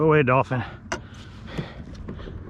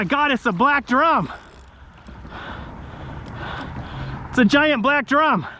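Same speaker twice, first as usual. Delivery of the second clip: high, thrilled angler; drawn-out amazed exclamations, pitched up; real excitement at a huge catch; excited; 8 semitones higher than usual. Real, not acted. Landing a huge fish.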